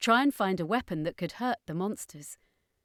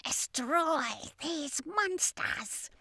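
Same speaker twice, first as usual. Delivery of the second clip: alien shout; 9 semitones higher, 4 dB quieter, much slower than usual. Acted. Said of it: "the new Hylexan commander shouted".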